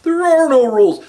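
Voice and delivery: sweet little delivery of a tough line; mild-mannered guy. Retold or imitated. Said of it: "That's how they sound".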